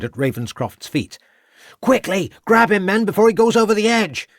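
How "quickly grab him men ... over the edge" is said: high, loud, fast orders to the men; urgent yell. A narrator's reading. Constable orders his men to grab the villain, a high loud urgent yell. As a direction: yell the order before he falls. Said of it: "yelled Crabbe".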